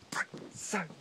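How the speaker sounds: high, breathy whisper. Pretend. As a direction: whisper it to yourself. Same phrase, whispered high.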